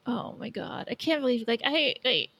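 ordinary talk, nothing marked

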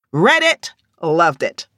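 quick little show-off; breezy brag. Acted. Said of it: "Latoya wagged her fingers".

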